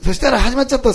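loud, high-pitched burst of excitement; excited announcement. Real, not acted. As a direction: burst out as the story turns. Dramatic turn in the story. (興奮して高く大きな声で)

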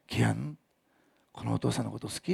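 soft, tender explanation; teacher kneeling to comfort a boy; gentle talk to a child. Retold or imitated. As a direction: speak softly to a hurting child.

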